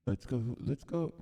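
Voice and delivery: high, fast cheer; excited squeal. Real, not acted.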